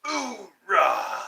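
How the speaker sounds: fierce Marine battle cry; loud gung-ho soldier. Acted. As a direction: roar it like charging in. Bayonet joke, Marine cheer.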